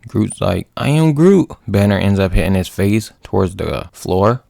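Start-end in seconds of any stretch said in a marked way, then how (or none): 0.8-1.5 loud, high wail of a call; mock anguished shout; acted distressed cry. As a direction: cry out after a falling friend. Groot loses Rocket.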